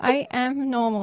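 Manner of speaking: high, loud, drawn-out protest; insistent retort. Retold or imitated. Speaker retorts to the kinesiology practitioner, retold insistent protest.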